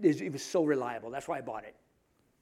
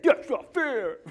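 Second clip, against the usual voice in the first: put-on much higher, louder childish whine; whiny protest; 9 semitones higher, 5 dB louder, slower than usual. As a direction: complain like a sulky kid. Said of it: "I'm sure that's how he said".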